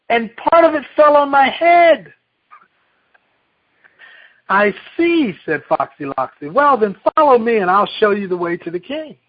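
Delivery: put-on lively read-aloud to children; storyteller reading a picture book; story reading. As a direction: tell the fable with bright animal voices. Reads Chicken Little aloud.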